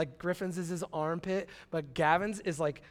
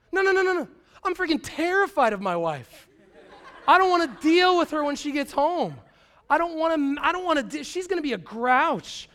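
mock dread; 9 semitones higher, 7 dB louder than usual. Mock dread of wife returning.

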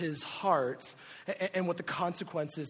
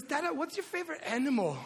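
put-on high, quick little-kid voice; imitating his toddler; 9 semitones higher, faster than usual. Two-year-old asking dad a question, high imitated child voice. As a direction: ask like a curious small child. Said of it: "And he goes".

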